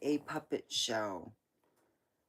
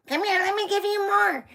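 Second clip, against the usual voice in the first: much higher, louder doting grandma voice; Polish grandma voice; 7 dB louder, faster than usual. Retold or imitated. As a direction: play the grandma pushing food on you. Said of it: "when you got a Polish grandma".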